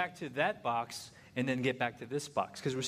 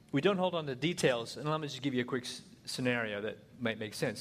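ordinary talk, nothing marked

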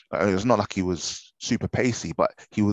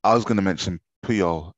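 ordinary talk, nothing marked

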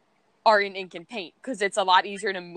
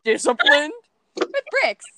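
Lilo impression; 6 semitones higher, 4 dB louder than usual. Fan quoting Lilo in a high child voice to her friend. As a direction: imitate Lilo earnestly listing her good habits. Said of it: "especially when Lilo's like".

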